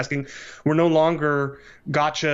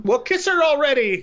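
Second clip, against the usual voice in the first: high, loud heckle from the crowd; imitated yell; 13 semitones higher, 4 dB louder than usual. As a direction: mimic an uncle yelling out impatiently from the back. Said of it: "yelled out from the crowd".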